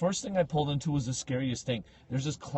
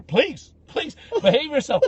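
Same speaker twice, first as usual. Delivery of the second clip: exasperated plea; 11 semitones higher, 5 dB louder than usual. Retold or imitated. Police officer pleading with heckler.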